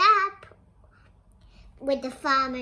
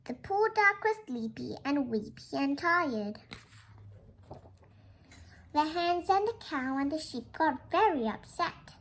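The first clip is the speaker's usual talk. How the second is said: ordinary delivery, nothing marked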